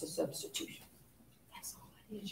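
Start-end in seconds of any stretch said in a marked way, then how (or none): 1.5-2.3 hushed whisper, much softer; whispered aside. Real, not acted. Teacher letting students in on how simple it is, whispered. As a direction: whisper it to the class like a secret.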